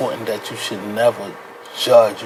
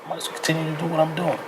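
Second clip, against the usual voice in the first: quick, higher, resigned mumble; an addict brushing off help, fast and higher; imitating a defeated addict; 6 semitones higher, 5 dB quieter, faster than usual. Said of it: "they're gonna be like".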